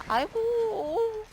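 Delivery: long high crying wail of despair; drawn-out wail. Real, not acted. Player wailing as the monster escapes, crying wail. Groaning as the hunt goes badly.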